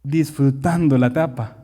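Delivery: ordinary talk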